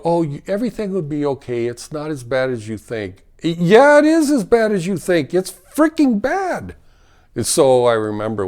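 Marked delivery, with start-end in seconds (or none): 0.0-3.2 mock reassurance. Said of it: "and they go". 3.4-6.8 much higher and louder, indignant; angry retort. Real, not acted. Rejecting bystanders' false comfort, indignant shout.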